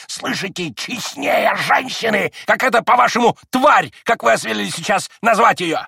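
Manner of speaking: put-on high, loud breathless old man ranting; breathless old speaker. A narrator's reading. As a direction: spit out every word, gasping. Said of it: "задыхаясь и брызгая с каждым словом слюной".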